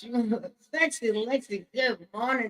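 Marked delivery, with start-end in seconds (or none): none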